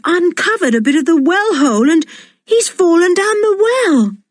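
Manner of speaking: high, startled explanation; surprised. A narrator's reading. Boy discovering the dog fell down the well, surprised. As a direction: realise with a shock what happened. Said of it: "He gave a shout of surprise".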